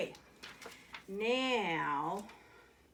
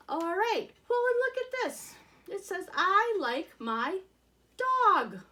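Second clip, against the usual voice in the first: 1 excited; 11 semitones higher, 7 dB louder than usual. Real, not acted. Excited reading the flip book.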